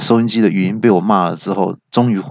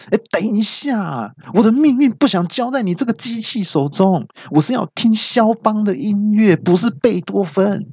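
high, exasperated cutting in; mock-annoyed high interruption; interrupting; 11 semitones higher than usual. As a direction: stop the machine mid-sentence. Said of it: "我就马上打断说".

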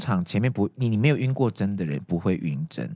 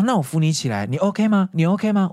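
raised-pitch worried nurse; imitated concerned, repeated questioning; urgent concern; 8 semitones higher, 3 dB louder than usual. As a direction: act out anxiously checking on a fainting patient. Nurse rushes to help fainting patient.

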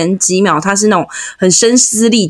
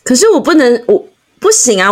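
ordinary talk

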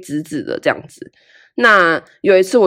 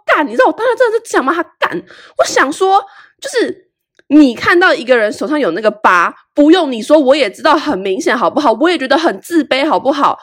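indignant rant; 7 semitones higher than usual. Real, not acted. Venting indignation at classmate.

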